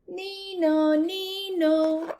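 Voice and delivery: high siren imitation; high nee-naw siren; siren call. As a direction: sing it like a siren. Siren sound.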